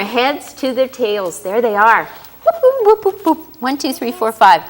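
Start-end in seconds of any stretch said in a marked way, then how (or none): 2.0-3.6 high sliding whoops, falling; playful whoop sounds. Acted. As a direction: whoop them out one by one. Snails sliding out.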